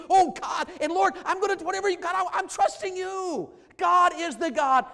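loud, high, shouted sermon climax; fiery preaching. Acted. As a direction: preach it with full force to the church. Preaching at full force.

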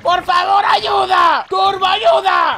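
panicked shouts, calling out for rescue; yelling for help. Acted. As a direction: scream for help from down in the trap. Trapped, screaming for help.

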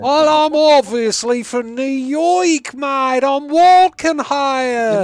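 put-on high, loud, slow New York accent sliding into Australian; bad New York accent. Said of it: "you sound more Australian".

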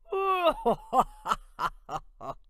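loud hearty laughter; a big lion laughing instead of roaring. Acted. Lion roaring with laughter at the happy ending.

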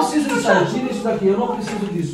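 high, loud outburst; furious rejection. Acted. Man refusing what he no longer needs, furious rejection. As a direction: reject it at a shout.

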